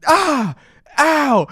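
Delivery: high loud ah and ow; retold yelps of pain. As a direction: cry out from a stinging hit. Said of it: "I was like".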